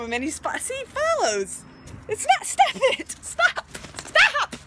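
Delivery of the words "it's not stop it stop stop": high, louder stops building up; shrieking at the pet to quit; frantic stop. Real, not acted. Pet biting her.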